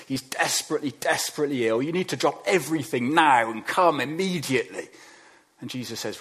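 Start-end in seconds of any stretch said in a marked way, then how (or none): 1.8-4.9 urgent plea. Retold or imitated. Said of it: "text comes through from Lazarus".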